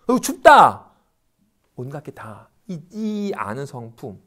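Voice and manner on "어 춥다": loud, high "brr, cold" outburst; acted exclamation of cold. Teacher acting a sensation popping up, loud cry of cold. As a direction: blurt it out as if suddenly chilled.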